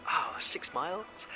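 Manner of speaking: slow, airy whispered disbelief; drawn-out, deflated whisper of mock horror; retold dismay. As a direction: act out sinking dismay under the breath. Retold dismay at distance home.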